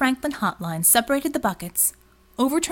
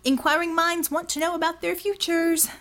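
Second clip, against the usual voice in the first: higher, playful mock-announcer tone; pitched-up teasing call; mock grandeur; 7 semitones higher than usual. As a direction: tease like a carnival barker. Mock announcement to empty alley.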